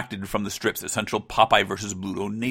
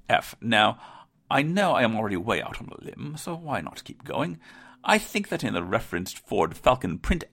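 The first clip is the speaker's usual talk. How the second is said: bad British accent; 2 semitones higher than usual. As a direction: read the letter in a hammed-up fake British accent. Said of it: "me doing a bad British accent".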